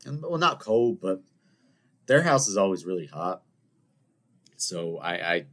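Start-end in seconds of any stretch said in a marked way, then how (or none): none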